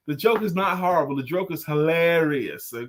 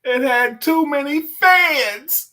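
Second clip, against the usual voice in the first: slow punchline; 8 semitones higher, 6 dB louder, much slower than usual. Acted.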